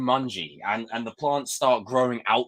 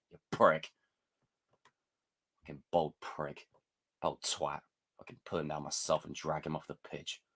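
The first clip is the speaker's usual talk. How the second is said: muttered insults; 7 semitones lower, 10 dB quieter than usual. Real, not acted.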